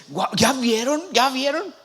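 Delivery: wow, then eager rising questions; acted excitement. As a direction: play the prophet thrilled by what he sees. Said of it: "este, emocionado".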